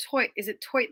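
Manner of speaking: normal talking voice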